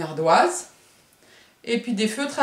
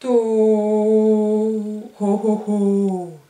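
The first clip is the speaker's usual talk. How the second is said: Ho, ho, ho, louder and held long; stretched O sound; 5 dB louder, much slower than usual. Shown as a demonstration. Teacher making the O sound for the class, drawn out. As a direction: sing out the O sound. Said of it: "le bruit de Monsieur O est dans cette syllabe-là".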